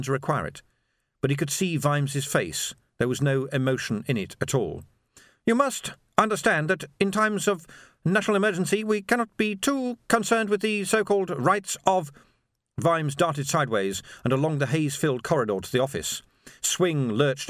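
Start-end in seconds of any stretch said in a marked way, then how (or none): 5.4-12.1 higher, precise and icy; a calm fanatic lecturing; Swing's cold voice. A narrator's reading. Swing's voice held.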